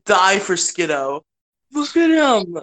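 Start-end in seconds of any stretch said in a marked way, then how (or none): 1.7-2.6 high doting coo. Real, not acted. Fawning over a cute goat Pokemon, cooing high.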